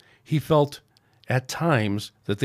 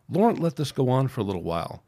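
usual speaking voice

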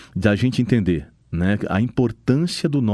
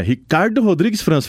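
scolding by full name; 9 semitones higher than usual. Retold or imitated. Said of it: "quando a mãe fala o nome completo".

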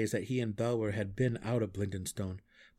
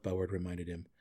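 usual speaking voice

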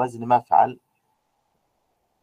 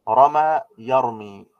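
slow, deliberate model of رمى يرمي; teacher slowly models رمى يرمي; slow modeling of the forms; 4 dB louder, much slower than usual. Shown as a demonstration. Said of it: "teacher models the verb forms".

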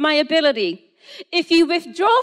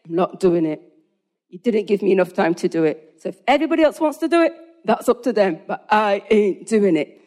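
pig-headed refusal; 6 semitones lower, faster than usual. Retold or imitated. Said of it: "very pig-headed and say".

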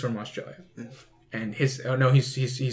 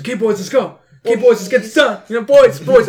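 high, loud repeated catchphrase; imitating London acquaintance; 8 semitones higher, 8 dB louder than usual. Host mocks acquaintance overusing a word, loud high mimicry. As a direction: hammer the word boys like an eager mate. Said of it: "He loved saying the word boys".

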